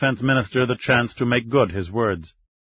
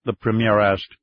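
plain reading voice

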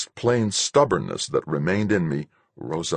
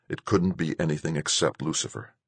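usual speaking voice

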